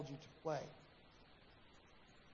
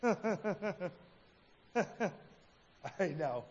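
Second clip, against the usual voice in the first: laughing agreement. Retold or imitated. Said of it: "he goes".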